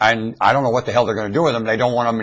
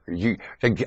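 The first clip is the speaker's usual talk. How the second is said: ordinary talk, nothing marked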